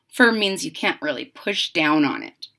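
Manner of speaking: plain reading voice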